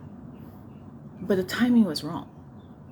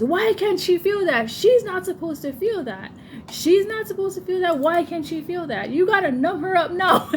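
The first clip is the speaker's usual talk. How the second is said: doctor yelling; 11 semitones higher than usual. Retold or imitated. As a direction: yell orders at the nurses. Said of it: "He's yelling at the nurses".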